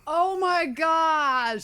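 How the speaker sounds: high, loud, long-drawn gasp of disbelief; shocked exclamation. Real, not acted. Co-host hearing the passenger's shocking remark, appalled and drawn out.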